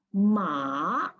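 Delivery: 'ma' dipping low, then rising; third tone. Shown as a demonstration. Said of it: "you have to lower down your tone".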